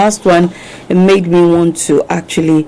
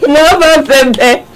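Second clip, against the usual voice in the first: slow, high punchline through laughter; helplessly amused, pitched-up quote; laughing repeat; 8 semitones higher, slower than usual. Retold or imitated. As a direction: wail it out while laughing.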